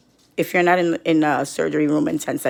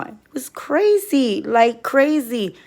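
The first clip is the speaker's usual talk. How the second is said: higher and slow, stretched out in disbelief; exasperated; 6 semitones higher, slower than usual. Real, not acted. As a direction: drag out how crazy it was. Outraged retelling of hospital.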